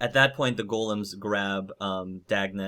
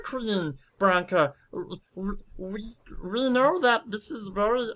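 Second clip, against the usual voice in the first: much higher, faltering character voice; Cogs, pleading; 11 semitones higher, 3 dB louder than usual. Acted. Cogs backing Dagna before Queen Branka, high halting plea. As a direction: stammer a high-pitched appeal.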